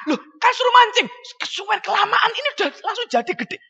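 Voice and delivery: high-pitched comic protest; mock complaining disciple. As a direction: whine like a disciple making excuses. Acts out complaining Indonesian disciple.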